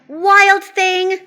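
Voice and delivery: high, loud scolding call; mother calling out. A narrator's reading. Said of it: "his mother called him".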